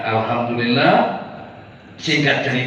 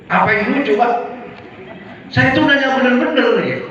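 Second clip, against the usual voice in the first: raised, offended voice demanding an answer; indignant protest; 8 semitones higher than usual. Retold or imitated. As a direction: push back hard, feeling mocked. Confronting the guard who laughed.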